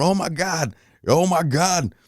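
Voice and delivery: high-pitched mock cry of alarm, held back in volume; retold panic. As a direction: reenact dread as the plane closes in. Retold panic as plane nears.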